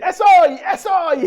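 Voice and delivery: high, loud, overjoyed cry of "¡Es hoy!"; excited cartoon shout. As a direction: burst out with childlike joy. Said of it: "él sale corriendo de su cuarto y dice".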